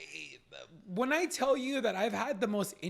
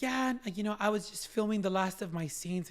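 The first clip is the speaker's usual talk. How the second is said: high-pitched British actress; British accent; 5 semitones higher than usual. Retold or imitated. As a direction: imitate a chatty British woman. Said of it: "in her British accent".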